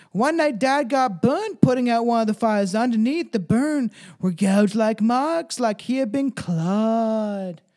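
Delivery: put-on loud, high British accent drifting off course; British accent going off. [name] recalling Dad's burn, British accent going off. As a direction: push the British accent until it goes weird, slowing at the end. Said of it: "he went kind of off there at the end".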